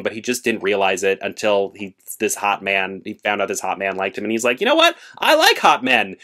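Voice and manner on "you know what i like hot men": playing the main character as it dawns on him; mock sudden revelation. Main character realizing he likes men, mock excited revelation.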